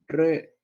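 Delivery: teacher sounding out "Récords" by syllables; stress on first syllable. Shown as a demonstration. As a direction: hit the RÉ of "Récords", pause before "cords". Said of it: "Es una palabra grave".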